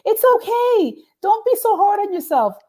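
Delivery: very high imitated caller voice; high-pitched mock consolation; consoling callers. Listeners phoning in to console the DJ, imitated high and soothing. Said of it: "They're like".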